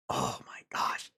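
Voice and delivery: hushed, whispered exasperation; exasperated whisper. Real, not acted. Telling viewers about an annoying kid, exasperated whisper. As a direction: whisper it as a weary aside.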